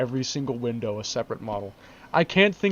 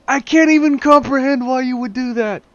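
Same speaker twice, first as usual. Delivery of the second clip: higher, louder cry of disbelief; incredulous; 9 semitones higher, 7 dB louder than usual. Real, not acted. Vlogger asking the maker why, high incredulous cry. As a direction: throw up your hands in disbelief. Exasperated rant about car model.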